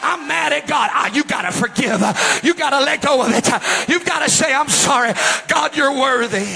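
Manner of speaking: high, urgent revival exhortation; full-force preaching. Real, not acted.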